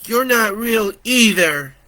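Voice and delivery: put-on high, small defiant child voice; brave child. A narrator's reading. Brandon defies the devil, high. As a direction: muster courage. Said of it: "as strongly as he could".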